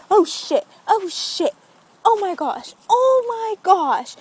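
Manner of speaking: high, rising alarm; acted-out panic. Driver realizing her retainers are gone, mounting mock panic. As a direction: escalate the panic, ending high and drawn out.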